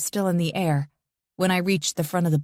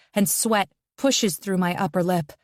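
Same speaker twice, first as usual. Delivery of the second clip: usual speaking voice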